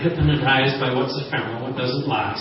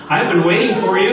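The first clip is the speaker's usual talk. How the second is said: excited; 5 semitones higher, 5 dB louder than usual. Retold or imitated. Wife greets husband at door.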